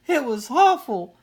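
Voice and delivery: high, loud, slow wail. A narrator's reading. Sister wailing to Mama after nightmare, loud. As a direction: wail it, burying her face. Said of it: "she wailed again".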